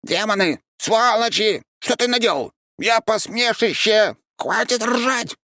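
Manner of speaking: very high, shrill yelling, slowing at the end; humiliated creature. A narrator's reading. Mocked creature shrieks at the laughing men, very high.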